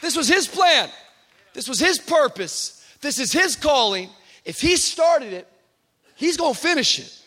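loud, high, rousing sermon voice; full-force preaching. Acted. Pastor rousing his church, preaching at full force. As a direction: fire up the congregation.

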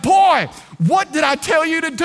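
father yells at the son after the near crash; scolding shout. Retold or imitated. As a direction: bark it at the son. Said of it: "He's like".

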